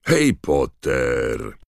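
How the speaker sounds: loud, very drawn-out growled call; Moody, booming. A narrator's reading. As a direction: thunder it slowly across the crowd. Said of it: "zagrzmiał Moody".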